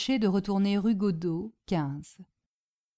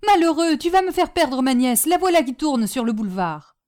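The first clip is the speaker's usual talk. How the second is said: loud, higher furious scolding; angry scolding; 5 semitones higher, 6 dB louder than usual. Acted. Aunt scolding the coachman who blocks her, loud and angry. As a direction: snap at the coachman in frustration.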